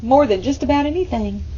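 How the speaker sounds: usual speaking voice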